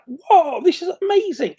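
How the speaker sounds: amazed. Retold or imitated. Everyone gushing over the new version, high mock amazement. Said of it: "Everybody was like".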